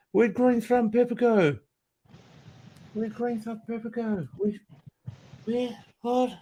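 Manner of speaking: high squeaky mock voice of viewers; imitating viewers. Streamer mimics viewers asking where he went, high squeaky voice. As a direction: pitch way up, mimic the asking crowd.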